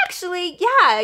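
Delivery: a high, loud, eager Actually, yeah; eager pipe-up. Retold or imitated. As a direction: jump in brightly, thrilled to be asked. Eagerly piping up for coffee.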